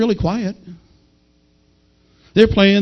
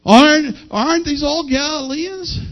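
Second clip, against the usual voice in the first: mimicked crowd; 7 semitones higher than usual. Preacher imitating the crowd hearing Galileans speak, mock puzzled. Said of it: "they're all talking like this".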